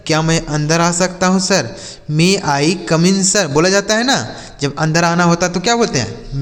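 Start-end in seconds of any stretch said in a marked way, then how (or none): 2.1-3.5 slow dictation. Shown as a demonstration. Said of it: "teacher slowly repeats the sentence".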